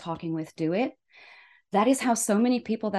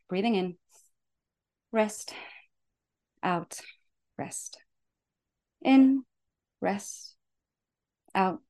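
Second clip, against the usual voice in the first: guided breathing; slower than usual. Shown as a demonstration. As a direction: lead the breath gently. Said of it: "Let's do that breathing".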